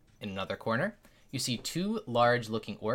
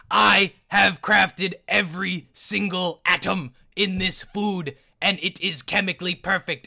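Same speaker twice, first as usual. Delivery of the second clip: loud chef; 7 semitones higher, 6 dB louder than usual. Acted. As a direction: lean in and over-enunciate proudly. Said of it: "he leans in very close to you and he's like".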